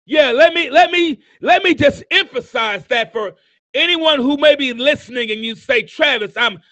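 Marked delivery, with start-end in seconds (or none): none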